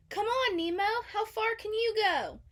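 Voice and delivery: put-on high, loud teasing kid voice; challenging Tad. A narrator's reading. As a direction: dare him, bright and pushy. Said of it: "Tad challenged".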